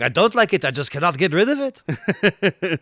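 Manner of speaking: hammed-up French complaint about his own accent. Quoting the robot's line in exaggerated French accent. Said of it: "he's got a French accent".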